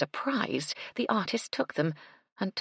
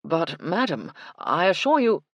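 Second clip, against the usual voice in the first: pressing, louder on assure; urgent; 5 dB louder than usual. Acted. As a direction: hurry to stop her leaving. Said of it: "she heard Mister Rawlins say urgently".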